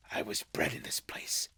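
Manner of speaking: whispered in wonder; awed whisper. Acted. Scrooge seeing his childhood home, whispering in awe. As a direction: breathe it out, barely voiced. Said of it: "clasping his hands together".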